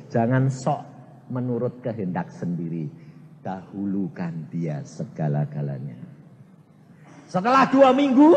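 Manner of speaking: ordinary delivery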